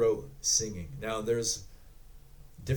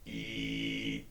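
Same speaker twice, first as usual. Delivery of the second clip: low throat tone with a whistle on top; throat whistling; 12 semitones lower, 5 dB quieter than usual. Shown as a demonstration. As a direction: whistle it through the back of the throat. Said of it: "So this one is the throat whistling".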